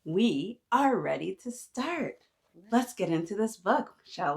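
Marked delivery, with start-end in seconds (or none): none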